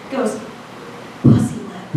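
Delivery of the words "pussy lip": mock-solemn whispered password; secretive whisper. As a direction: whisper it like a top-secret code. Friend reveals secret password.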